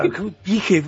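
P H E V said as one word, "PI-jef"; mocked mispronunciation. Co-host deriding those who cannot pronounce English, P H E V as "pijef". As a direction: repeat P H E V as "pijef", mocking it. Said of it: "ni siquiera saben pronunciar la P H".

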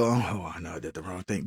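sheepish, barely audible admission; quiet admission. Retold or imitated. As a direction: mumble the confession as if ashamed of it. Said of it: "and you go".

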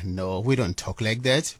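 ordinary talk

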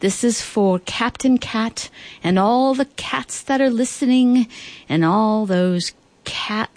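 slow, stretched-out song dedication with lifted pitch; radio dedication. Real, not acted. Host dedicates music to cat lovers, slow radio dedication. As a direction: draw out each phrase as you introduce the song.

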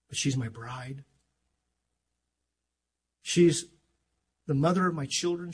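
normal talking voice

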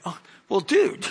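ordinary talk, nothing marked

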